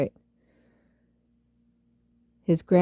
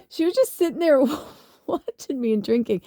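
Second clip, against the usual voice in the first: higher voice, laughing mid-sentence; laughing while telling; 8 semitones higher than usual. Real, not acted. Recalling friend just watching and drinking, laughing. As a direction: let laughter break into the telling.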